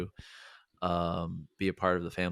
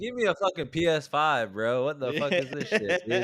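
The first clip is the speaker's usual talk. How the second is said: put-on bratty kid voice; 5 semitones higher than usual. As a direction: rant like a spoiled ungrateful kid. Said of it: "They'd be like".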